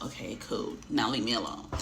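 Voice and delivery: annoyed man dismissing the passenger; low brush-off. Retold or imitated. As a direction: wave her off quietly. Dismissive reply.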